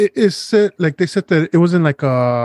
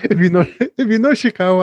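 mocking echo; 7 semitones higher than usual. Teasing a friend about where he lives, mocking echo. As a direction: parrot his line back to tease him.

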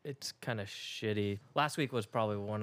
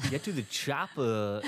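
comic double impression of action-film catchphrase; one celebrity imitating another's famous accent; Christopher Walken doing Arnold Schwarzenegger; 3 semitones higher than usual. Showing off a layered impression, Walken as Schwarzenegger. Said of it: "Christopher Walken doing Arnold Schwarzenegger".

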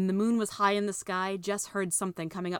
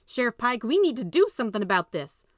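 demanding mother; 5 semitones higher, 6 dB louder than usual. Acted. Mama demands action.

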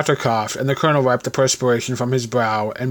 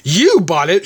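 raised-voice, startled question; shouted; 5 semitones higher, 5 dB louder, slower than usual. A narrator's reading. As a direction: exclaim it a little louder and higher. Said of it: "shouted the Colonel".

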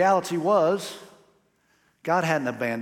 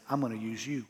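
gentle; 4 semitones lower, 8 dB quieter than usual. Retold or imitated. God's gracious words, softly voiced.

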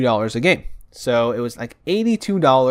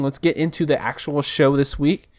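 ordinary talk

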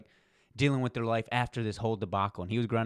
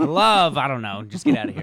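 dismissive author; 3 semitones higher, 8 dB louder than usual. Retold or imitated.

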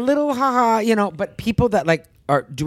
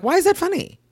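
outraged high question; 12 semitones higher than usual. Retold or imitated. Retelling the prank video, an indignant high demand. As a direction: pitch shoots way up, indignant. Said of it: "I'm like".

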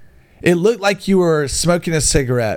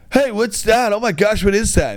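fake surprise; 8 semitones higher than usual. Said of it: "And then I was like".

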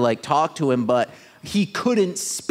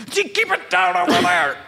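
cranky neighbor. Pretend. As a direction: shout at the noise next door. Yelling at noisy neighbors.

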